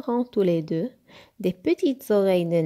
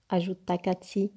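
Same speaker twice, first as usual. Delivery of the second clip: plain reading voice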